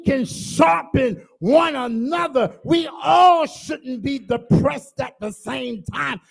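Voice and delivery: loud, driving preacher's shout; preaching at full force. Acted. Preacher rallying the congregation, full-force shout. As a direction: lift the church with a full-throated preach.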